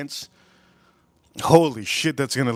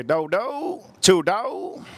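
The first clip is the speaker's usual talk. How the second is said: mock Vietnamese accent; 5 semitones higher, much slower than usual. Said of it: "They say".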